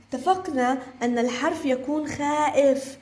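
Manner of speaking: ordinary talk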